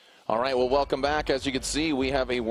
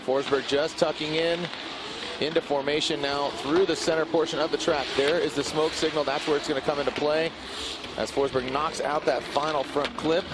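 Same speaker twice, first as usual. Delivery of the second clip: live play-by-play; 5 semitones higher than usual. Acted. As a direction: call the run fast and hyped.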